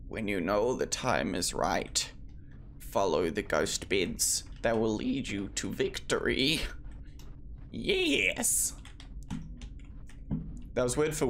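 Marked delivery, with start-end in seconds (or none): 0.1-6.6 slow, low mock Russian grandmother; lightly voiced old Russian granny, slow; grandmother's Russian accent. Gamer quoting his grandmother in a slow mock Russian accent. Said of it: "that was even the same accent she used". 7.7-8.7 high excited cry; a sudden high burst of triumph; triumphant yes. Real, not acted. Gamer cheering the game line, high and excited.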